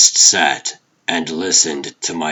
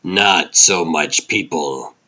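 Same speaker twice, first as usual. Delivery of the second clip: usual speaking voice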